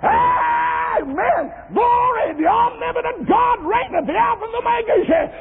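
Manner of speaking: very high, ecstatic praise shouts; preaching at full cry. Real, not acted. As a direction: cry out each word of praise, stretched and soaring. Climax of praise.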